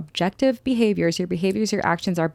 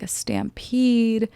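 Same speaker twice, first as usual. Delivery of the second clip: ordinary talk, nothing marked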